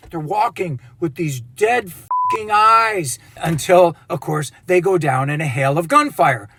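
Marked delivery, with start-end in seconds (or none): none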